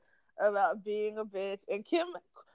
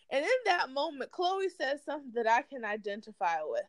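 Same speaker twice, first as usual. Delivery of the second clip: ordinary talk, nothing marked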